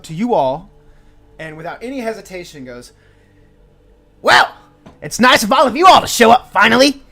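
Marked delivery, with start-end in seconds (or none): 4.2-7.0 put-on high, loud grumpy old gnome scolding; a crotchety gnome hollering at the adventurers for showing up late; cranky old gnome yelling. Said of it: "very cranky ... gnomish man who is just yelling at you all".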